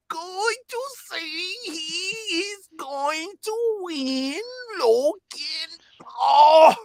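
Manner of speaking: slow, high, straining delivery; agonized drawn-out squeal, swelling at the end; pained strain. Pretend. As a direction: force each word out as if it hurts. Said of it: "That's why I said it hurt".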